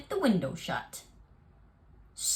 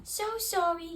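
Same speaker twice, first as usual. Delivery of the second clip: mouse voice; 8 semitones higher than usual. Acted. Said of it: "said the mouse".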